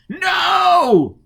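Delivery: very high, loud shriek; terrified scream. Acted. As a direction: shriek it in terror. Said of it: "Forrest screamed".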